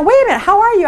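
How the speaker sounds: high, eager how-are-you; a friend keen to catch up; gushing friend. Retold or imitated. Friend answering the call, high and eager.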